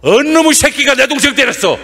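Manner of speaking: rough regional big-brother rage; angry big brother. Retold or imitated. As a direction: blurt it out hot-headed, no questions asked. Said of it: "전라도 사람들은 동생이 맞고 왔어".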